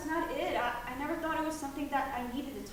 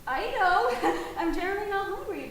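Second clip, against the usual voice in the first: laughing; 6 semitones higher, 10 dB louder than usual. Acted. Mother laughs off coffee remark.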